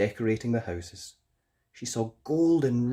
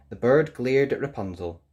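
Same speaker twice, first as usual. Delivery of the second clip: ordinary delivery, nothing marked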